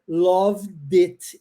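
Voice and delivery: "loved it" slow as one word, lovdit; linked d. Shown as a demonstration. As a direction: join the D to the I. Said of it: "Eu junto o D com o I". ("loved it" juntando o D com o I, devagar)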